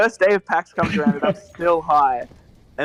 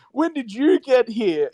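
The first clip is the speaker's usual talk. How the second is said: mock yell; 7 semitones higher, slower than usual. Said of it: "he's just yelling this".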